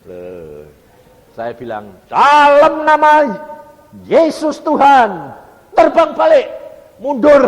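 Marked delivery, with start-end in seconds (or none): 2.1-7.4 high, drawn-out commanding roar; shouted command. Retold or imitated. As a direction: order it back at full force. Commands the tempter in Jesus' name. (perintah lantang dengan teriakan panjang)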